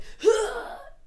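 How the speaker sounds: high, loud gasp; re-enacted gasp of pain. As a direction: suck in air sharply as if hurt. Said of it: "No, I went".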